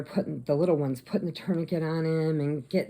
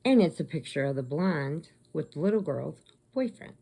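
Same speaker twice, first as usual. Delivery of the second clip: usual speaking voice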